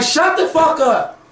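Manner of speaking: high, slow, exasperated shut the fuck up; hammed-up irritation at a whiny character; mock angry. A fan scolding the boy king on screen, mock furious and high.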